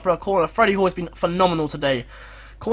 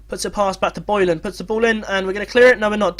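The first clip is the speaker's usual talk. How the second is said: ordinary delivery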